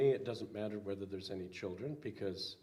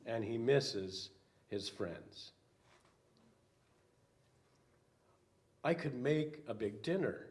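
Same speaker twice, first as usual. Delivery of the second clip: put-on lively read-aloud for children; storybook reading; 2 semitones higher, 6 dB louder than usual. A narrator's reading. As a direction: read it like a picture book to kids. Pastor reads children's picture book.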